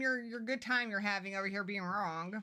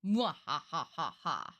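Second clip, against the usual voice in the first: slow, low villain cackle; evil vampire laugh; 8 semitones lower, much slower than usual. Acted. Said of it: "We're not doing that voice the whole time".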